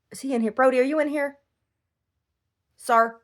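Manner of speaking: high-pitched call to a pet; calling the dog. Real, not acted. Owner looking for dog Brody, high questioning call. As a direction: lift it high, checking for the dog.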